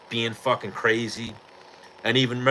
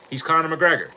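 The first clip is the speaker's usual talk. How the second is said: ordinary talk, nothing marked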